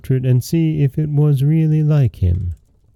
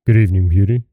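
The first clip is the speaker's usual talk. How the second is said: a lightly voiced gruff beast, a touch lower; 4 semitones lower, much faster than usual. A narrator's reading. The beast greeting Beauty at supper, lightly gruff. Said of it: "only said gruffly".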